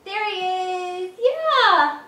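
high, loud happy cheer; delighted cheer. Real, not acted. Toddler found the dog.

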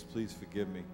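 ordinary delivery

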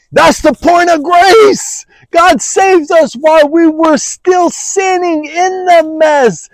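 high-pitched impassioned preaching; preaching at full force. Acted. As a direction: pile up each in the with rising fervour.

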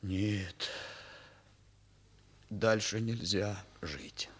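slow hushed whisper; despairing whisper. Acted. Boy whispers to himself in despair, slow hopeless whisper. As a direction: breathe it out, hopeless.

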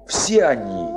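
ordinary talk

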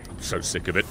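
low, soft grumble; quiet frustrated mutter. Real, not acted. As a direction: mutter it under your breath.

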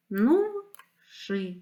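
"бризнувши" slowly as бриз, нув, ши; split into syllables. Shown as a demonstration. Teacher dividing a word for pupils, "бризнувши" syllable by syllable. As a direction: clear gaps between the three syllables of "бризнувши". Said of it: "слова на склади".

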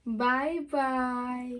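very slow, soft drawn-out bye bye; hushed, stretched-out farewell; soft slow goodbye. Shown as a demonstration. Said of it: "નીચે અવાજે કાં તો હાવ ધીમું ધીમું બોલીશ".

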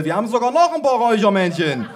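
high, loud delighted holler; grandma calling. A narrator's reading. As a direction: holler high from inside the fog. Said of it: "rief meine Oma aus dem Nebel".

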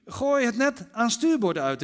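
slow, loud, high-pitched shout of instruction; calling out. A narrator's reading. Jesus tells the fishermen where to cast, calling out. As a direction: call the order out over the water. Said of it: "riep Jezus".

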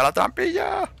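high, loud squeal of excitement; squealing shout at spotting the hatch; excited high shout. Real, not acted. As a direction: yell it out high, thrilled.

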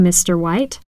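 normal talking voice

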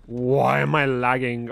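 slow, drawn-out complaint; exasperated drawl. Real, not acted. Streamer complaining about game lag, slow exasperated drawl. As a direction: stretch out WHY in exasperation.

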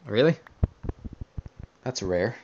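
ordinary talk, nothing marked

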